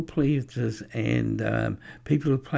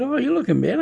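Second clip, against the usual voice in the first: excited greeting; 14 semitones higher, 6 dB louder, much faster than usual. Retold or imitated. Greeting the man who got better.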